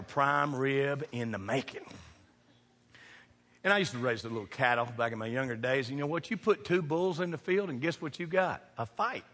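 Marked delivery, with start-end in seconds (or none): none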